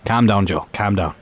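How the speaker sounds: ordinary delivery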